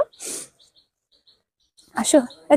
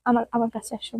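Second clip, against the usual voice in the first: soft soothing; 3 semitones lower, 7 dB quieter than usual. Real, not acted. Soothing a crying child.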